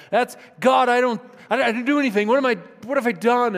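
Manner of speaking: high, loud desperate pleading; anguished outcry. Acted. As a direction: cry out your innocence in anguish. Job crying out to friends.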